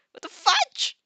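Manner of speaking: rising high yelp of disbelief; surprised. Real, not acted. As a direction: shriek it as the mic glitches again. Mic acting up again.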